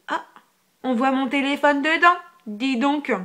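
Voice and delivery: loud, high-pitched amazed exclamation; playful surprise. Real, not acted. Spots her phone in the mirror. (surprise amusée, voix haute et forte)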